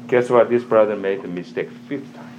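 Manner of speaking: ordinary delivery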